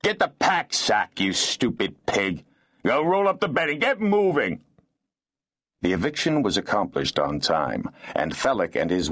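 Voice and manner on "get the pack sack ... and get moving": high, enraged snarl; furious, pitched-up bullying; bitter snarling rage. A narrator's reading. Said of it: "snarling in bitter rage".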